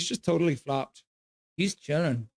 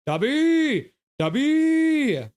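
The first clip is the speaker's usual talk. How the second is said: loud high call of the dog's name; 11 semitones higher, 5 dB louder, much slower than usual. Real, not acted. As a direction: call him sharply across the room. Calling the dog sharply.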